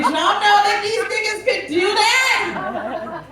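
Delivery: high, slow, laughing shout of disbelief; incredulous shout. Real, not acted. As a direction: yell it at the crowd in disbelief. Shocked punchline shouted to crowd.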